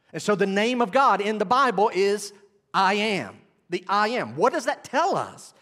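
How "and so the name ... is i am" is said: ordinary delivery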